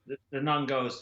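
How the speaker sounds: slipping early into an Irish accent; lightly Irish-accented lead-in. Real, not acted. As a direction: narrate with a hint of Irish. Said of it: "got into your Irish accent before she starts talking".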